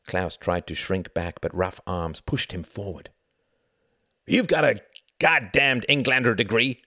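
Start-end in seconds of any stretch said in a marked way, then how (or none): 4.3-6.8 loud, higher-pitched impatient order; angry shout. A narrator's reading. Jorgen thrusts the paper at Klaus, angry shouting. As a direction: snap loudly at your underling.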